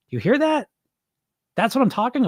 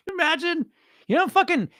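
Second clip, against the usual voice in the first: very high, laugh-choked words; squeaking through the giggles; real laughter; 11 semitones higher than usual. Real, not acted. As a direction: try to talk while still cracking up. Recovering from laughing at own skit.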